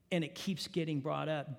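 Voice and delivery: ordinary talk, nothing marked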